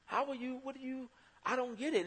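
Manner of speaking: high-pitched wonder at someone's calm; amazed disbelief. Retold or imitated. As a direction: marvel at a friend's happiness. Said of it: "Who I'm like".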